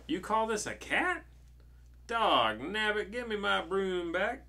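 high, drawn-out comic outrage; indignant storybook witch. Acted. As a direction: complain loudly as a put-out cartoon witch.

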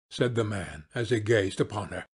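ordinary delivery

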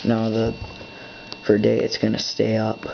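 ordinary talk

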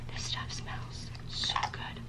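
breathy hushed whisper, slowed down. Real, not acted. Whispering to viewers while sniffing the body wash. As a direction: lean in and whisper how good it smells.

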